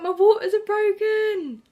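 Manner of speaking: loud, high, drawn-out yell; retold shout. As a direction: yell the news through the house. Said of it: "So I just shouted".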